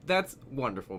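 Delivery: usual speaking voice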